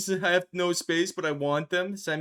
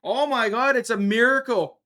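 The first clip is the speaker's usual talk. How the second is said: higher, louder mock astonishment; playful stunned exclamation; mock amazement; 7 semitones higher, 6 dB louder than usual. Real, not acted. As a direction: gasp it like a miracle happened. Chatter finally shows up.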